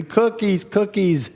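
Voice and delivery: put-on high whining child's cry; whiny child. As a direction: whine it like a little girl wanting cookies. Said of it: "she starts screaming".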